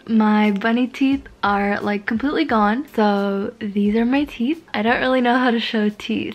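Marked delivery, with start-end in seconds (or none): 0.1-5.0 talking with lip held down; finger pulling lip down. Real, not acted. Seen 0: Finger holds lower lip down, showing teeth, throughout labelled words.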